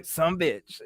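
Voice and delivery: "son bitch" clipped to two words, Southern; Southern accent. Shown as a demonstration. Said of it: "down there, they say".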